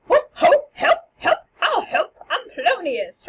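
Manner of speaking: high, loud comic yelps for help; hammed-up fluster; flustered Polonius. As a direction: bumble in a panic behind the curtain. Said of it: "And he starts".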